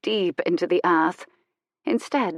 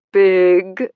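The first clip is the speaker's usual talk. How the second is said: teasing prompt; 8 dB louder than usual. A narrator's reading. Vicar teasingly prompts her.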